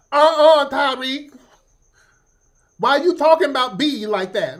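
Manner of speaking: high, loud indignant fan; sassy super fan caricature; mock super fan. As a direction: defend the queen in outrage. Imitating angry [name] super fan.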